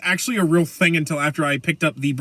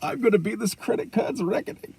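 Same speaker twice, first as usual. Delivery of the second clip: hammed-up Bane voice, pitched up; Bane impression; 5 semitones higher, 3 dB quieter than usual. Podcaster quoting Bane's credit card threat, mock-menacing.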